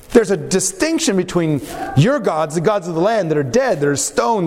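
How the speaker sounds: normal talking voice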